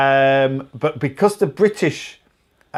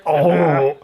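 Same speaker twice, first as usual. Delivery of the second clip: ordinary delivery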